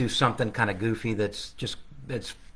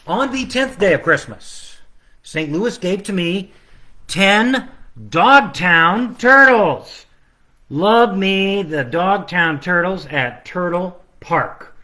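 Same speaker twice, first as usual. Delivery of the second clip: slow, drawn-out sing-song, turtles stretched louder; carol verse; 5 semitones higher, 4 dB louder, slower than usual. Acted. Illustrator presenting day ten of his carol. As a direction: announce it like a carol.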